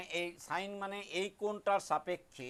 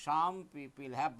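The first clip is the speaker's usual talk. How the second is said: very slow, drawn-out chant; slow recitation; 3 semitones lower, much slower than usual. Shown as a demonstration. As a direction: recite it slowly so they can memorize. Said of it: "উচ্চারণটা হলেই হবে". (ধীরে ধীরে আবৃত্তি)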